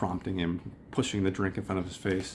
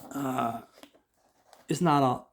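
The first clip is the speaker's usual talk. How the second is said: ordinary delivery, nothing marked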